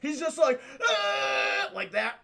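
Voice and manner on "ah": high, loud imitated rage scream; mock furious yell; angry yell. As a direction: let out a hammed-up action-hero roar. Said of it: "like, yelling anger faces ever".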